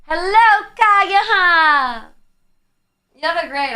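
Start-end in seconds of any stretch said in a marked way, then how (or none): 0.1-2.1 higher, louder hello, name drawn out; sing-song welcome to a viewer; cheery greeting. Real, not acted. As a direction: call out a bright, happy hello.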